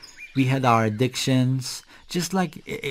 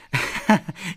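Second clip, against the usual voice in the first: short, higher-pitched chuckle; acted laugh; 4 semitones higher than usual. Reacting to applauding with hands, short laugh. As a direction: give a brief laugh on cue.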